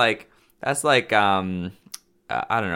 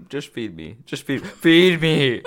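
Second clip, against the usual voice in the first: greedy monster voice; 4 semitones higher, 6 dB louder, slower than usual. Pretend. Greedy mock demand.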